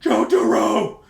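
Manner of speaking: loud high hammed-up cry; mock cry out. Reader voicing the figure, loud mock cry. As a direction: wail the name. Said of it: "it cried out repeatedly".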